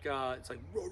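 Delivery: ordinary talk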